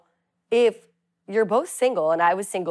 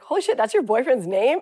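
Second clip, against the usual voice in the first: surprised; 5 semitones higher, 5 dB louder than usual. Retold or imitated. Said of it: "they're like".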